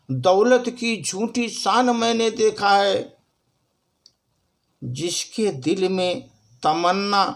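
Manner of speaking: stage recital, lines repeated for effect; poem recitation. Acted. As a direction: recite it like a shayari to an audience. Recites own verse after announcing a presentation.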